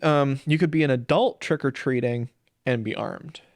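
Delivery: usual speaking voice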